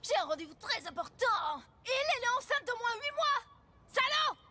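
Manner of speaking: high, loud jealous tirade; furious shouting. Acted. Jealous girlfriend accusing him of lying and cheating, furious shouting. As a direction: hurl accusations, building to a screamed insult.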